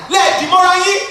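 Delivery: loud, high mock-mother order; imitated commanding mother. Mother ordering her boys to hug, imitated command.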